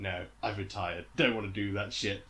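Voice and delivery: low, deadpan acted refusal; mock weary refusal. Retired star refusing the cartoons, mock weary. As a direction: turn them down in a low, unbothered voice.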